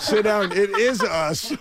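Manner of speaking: high, laughing mock shout; laughing heckle. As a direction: heckle again through laughter. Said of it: "another voice cried out".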